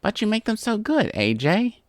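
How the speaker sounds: high, wheedling plea; beg, pitched well up; pleading. A narrator's reading. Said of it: "Rainbow pleaded".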